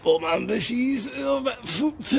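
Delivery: high comic voice. Pretend. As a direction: squeak it out in a high silly voice. High put-on voice at worn bushes.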